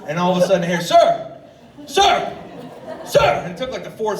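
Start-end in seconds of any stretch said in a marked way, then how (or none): 0.8-3.4 loud calls climbing higher each time; calling out sir. Retold or imitated. Gas station clerk calling a customer, rising shouts. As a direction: shout Sir across the store, getting more urgent.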